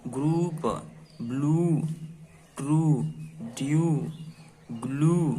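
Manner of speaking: each word said clearly with pauses; words the children repeat, said clearly; read for repeating. Shown as a demonstration. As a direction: say it so they can repeat after you. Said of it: "आप भी मेरे साथ बोलिएगा".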